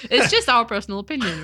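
laughing while talking. Real, not acted. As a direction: speak through a laugh. Laughing through joke.

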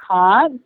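can't with a long "ah", like "cahnt"; British pronunciation. Shown as a demonstration. Actress admitting she still slips, British can't. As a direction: let can't slip out the British way, vowel as in "father". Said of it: "occasionally I still slip and say".

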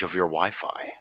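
breathy whisper. Pretend. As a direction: drop to a whisper. Whispered aside.

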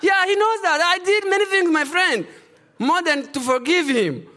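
high, loud, laughing emphasis; pushing it home with a laugh; laughing, exultant and loud; excited laughing insistence. Real, not acted.

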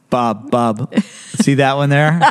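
low conspiratorial stable gossip; scheming horse. Pretend. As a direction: play a sly horse picking its target. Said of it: "the horses are in the stalls going".

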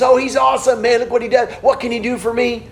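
high-pitched mock fan enthusiasm; gushing fans. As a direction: imitate self-interested fans gushing. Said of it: "They're big fans of Jesus".

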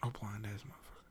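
fast whispered jab; whispered aside. Real, not acted. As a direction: whisper it under your breath, quickly.

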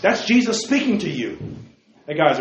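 usual speaking voice

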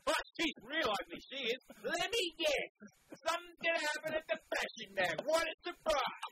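high mocking jeers at the TV; sarcastic jeering. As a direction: jeer sarcastically at the TV. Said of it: "while I scream".

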